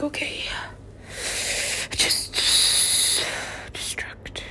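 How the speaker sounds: hushed, breath-only words; overwhelmed whisper. Real, not acted. Speaker giving up on the verge of tears, whispering. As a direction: collapse into a faint whisper.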